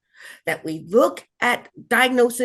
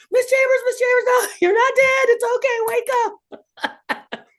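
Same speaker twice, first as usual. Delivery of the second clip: high, louder, hurried nurse voices; urgent nurses; 13 semitones higher, 3 dB louder than usual. Retold or imitated. Nurses running over to wake a patient, high urgent call.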